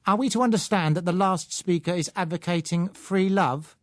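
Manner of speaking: hard, cold, cutting question, a touch of steel; a hint of an icy, unbending woman; carbon steel voice. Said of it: "using a kind of carbon steel voice".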